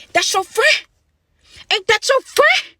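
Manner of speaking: high slow teasing taunt; mocking sing-song. Real, not acted. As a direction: tease it like you caught them. Mocking the supervisor affair.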